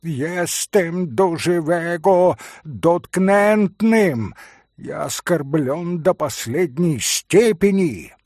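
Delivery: offended Polish pan. Acted. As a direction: proclaim the insult with wounded pride. Пан оскорблён, польский акцент. (оскорблённый пан с польским акцентом, медленно)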